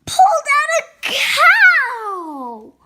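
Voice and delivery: loud, high, drawn-out shout of amazement; astonished reveal. A narrator's reading. Reader reveals what came out of George, astonished shout. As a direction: shout the big surprise, stretching it out.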